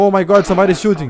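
high, loud panicked cry; panicked shout at gunshots; real alarm. Real, not acted. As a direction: cry out at sudden gunfire. Gunfire nearby.

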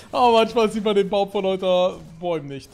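laut und lachend gesprochen. Real, not acted. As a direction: sag es noch lachend über den Fehler. Lachend über eigenen Fehler. (noch lachend, lauter)

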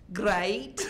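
high, comic put-on exclamation; hammed-up high-pitched pronouncement; mock bedroom announcement. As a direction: exclaim it high, as a pretend opener. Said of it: "and then I would say".

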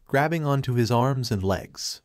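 ordinary delivery, nothing marked

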